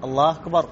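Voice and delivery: "Allaahkbar", أكبر without its opening vowel; dropped hamza. Shown as a demonstration. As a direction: join الله straight onto the k of أكبر. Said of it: "ما عاد ينطق الهمزة". (إسقاط همزة "أكبر")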